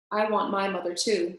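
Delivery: plain reading voice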